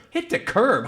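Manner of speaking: incredulous. Retold or imitated. Dad reacting to son.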